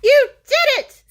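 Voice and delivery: high, loud "you did it"; proud cheer. Acted. Dad cheering his son's success, loud and high.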